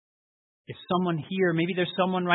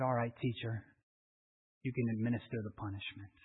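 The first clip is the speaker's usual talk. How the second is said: quiet resolve; 5 semitones lower, 9 dB quieter than usual. Acted. Farm boy offers himself.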